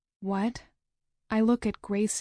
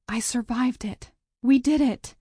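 rising to a louder triumphant exclaim; 4 semitones higher, 4 dB louder than usual. Acted. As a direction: burst out with relief. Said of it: "I exclaim".

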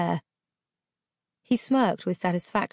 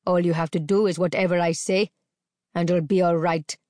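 louder, controlling menace; menacing captor; 3 dB louder than usual. A narrator's reading. Captor telling the girl to obey. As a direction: lay down his rule. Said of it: "captor commanding".

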